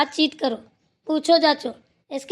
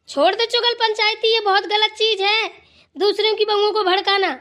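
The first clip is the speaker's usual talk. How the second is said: higher-pitched stern reproach; angry scolding; 6 semitones higher than usual. Acted. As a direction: tell the gossip off from above. Grandma scolds gossiping neighbour.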